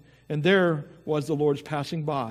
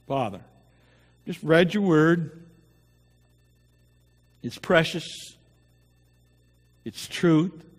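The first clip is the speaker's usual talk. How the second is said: opening prayer; 4 semitones lower than usual. Acted. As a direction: pray gently over the congregation. Pastor leads the opening prayer.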